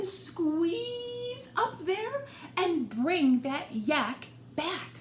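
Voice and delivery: lively picture-book read-aloud; reading to children. A narrator's reading. Storyteller reading to a little listener, bright and lively.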